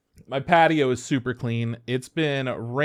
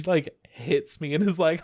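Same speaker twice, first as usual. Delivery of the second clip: ordinary talk, nothing marked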